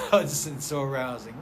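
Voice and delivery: normal talking voice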